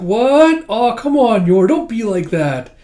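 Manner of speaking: high, loud, drawn-out pleading; whiny protest. Retold or imitated. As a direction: whine at being pushed out the door. Yuri protests being pushed out.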